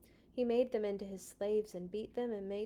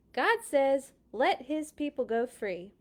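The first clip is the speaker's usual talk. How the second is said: louder, higher demand; insistent Moses; 4 semitones higher, 8 dB louder than usual. Acted. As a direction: press the message home. Moses pressing the king.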